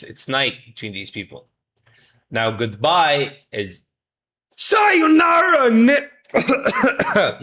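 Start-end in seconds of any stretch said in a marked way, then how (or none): 4.6-7.4 Sayonara shouted very high and stretched out slowly; hammed-up Sayonara. Shown as a demonstration. Teacher showing the class an over-the-top Sayonara, high, loud and slow. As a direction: belt out Sayonara like a dramatic farewell.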